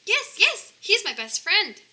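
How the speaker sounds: high, loud cry of joy; joyful shout. Acted. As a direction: shout out with relief and delight. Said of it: "shouted Lisa".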